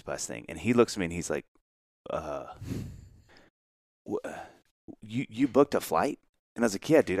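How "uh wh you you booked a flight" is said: stunned stammer. Retold or imitated. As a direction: imitate being caught off guard, long halting gaps. Acting Mark's stunned reaction.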